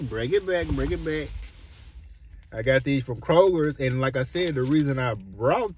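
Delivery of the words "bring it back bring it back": excited. Real, not acted. Playful DJ-style rewind call.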